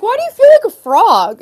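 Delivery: high, loud imitation of a puzzled friend; squeaky mock question, a friend teasing. Friend on the live stream asking why, high and loud. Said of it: "She was like".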